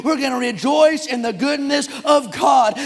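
high, loud revival preaching; shouted, exultant pulpit cadence; full-force preaching. Real, not acted. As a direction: preach it like a rousing revival, driving every phrase.

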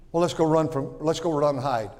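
ordinary delivery

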